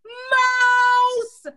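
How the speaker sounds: high, loud prank scream. A narrator's reading. Ronald screaming to scare his family, loud and high. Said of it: "he liked to scream out".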